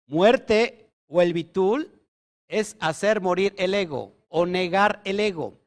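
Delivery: usual speaking voice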